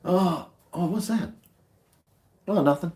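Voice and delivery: high, startled then coy; mock surprise. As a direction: act caught out, then innocent.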